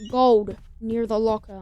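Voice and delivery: usual speaking voice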